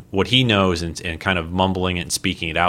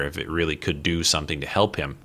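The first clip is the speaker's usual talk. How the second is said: ordinary talk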